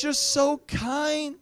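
high, long-drawn mock swooning; gushing stewardess. Ticket lady adoring the traveler at her counter, mock gushing. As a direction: imitate her slow, adoring gush. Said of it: "She says".

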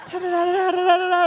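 sing-song mock sob, laughing through it; mock wailing da-da-da. She wails after being passed over, hammed-up and high.

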